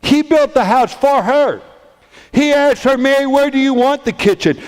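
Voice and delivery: high, driving, impassioned storytelling; full-force preaching. A narrator's reading. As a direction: preach it in building, insistent repetitions.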